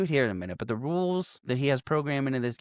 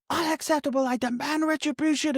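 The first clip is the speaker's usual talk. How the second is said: much higher, hammed-up huffy protest; indignant Carl; 14 semitones higher than usual. Retelling Carl's outburst in a high, huffy put-on voice. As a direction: play a pompous man who is mock outraged. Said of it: "Carl's fucking pissed and was like".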